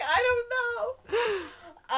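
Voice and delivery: very high, helpless whine; mock wail. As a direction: wail it comically, close to laughing. Acted helpless answer to friend.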